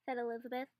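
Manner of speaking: ordinary delivery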